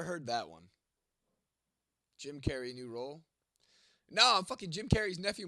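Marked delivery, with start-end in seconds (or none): 4.1-5.5 indignant. Real, not acted. Correcting a chat name.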